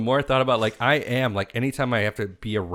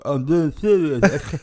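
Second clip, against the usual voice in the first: higher, louder, drawn out mock earnest; slow fake sincerity; 7 semitones higher, 7 dB louder, slower than usual. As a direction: keep a straight face while lying. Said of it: "I'm like".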